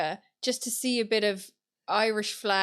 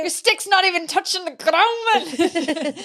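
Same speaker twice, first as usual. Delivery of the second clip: mock angry Scot; 8 semitones higher, 7 dB louder than usual. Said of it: "really angry Scottish truck driver".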